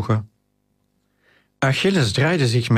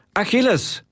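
roepen; 10 semitones higher than usual. A narrator's reading. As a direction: call out the name urgently, pitch jumping up. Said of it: "Titus hapte naar adem en riep". (hoge, dringende roep)

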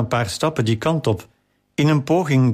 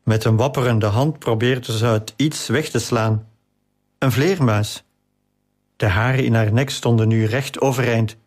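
plain reading voice

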